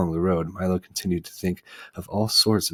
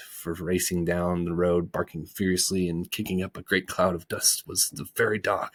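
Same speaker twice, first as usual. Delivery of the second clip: ordinary delivery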